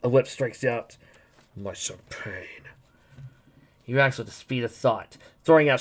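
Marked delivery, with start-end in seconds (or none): 1.6-2.7 slow breathy whisper. Acted. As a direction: mourn it under your breath. Character mourns lost champagne.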